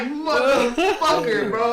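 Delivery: high, loud, drawn-out curse through laughter; exasperated mock outrage. Friend never called him back, loud laughing exasperation. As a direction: stretch the curse out in laughing disbelief. Acted reaction to not being called.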